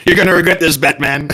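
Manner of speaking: high, hammed-up villain threat to Batman; super villain voice. Mocking the UAE as a villain taunting Batman, hammy villain voice. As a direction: sneer it like a cartoon super villain. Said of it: "super villains speaking to Batman".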